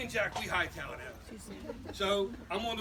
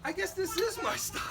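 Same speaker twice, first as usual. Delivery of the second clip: cocky; 11 semitones higher than usual. Retold or imitated. Cocky thought while driving wild.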